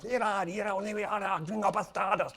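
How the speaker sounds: high, fast imitated angry Spanish yell; a hammed-up furious Mexican yell; mock screaming man. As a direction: rattle it off like an enraged local. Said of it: "this guy just starts screaming".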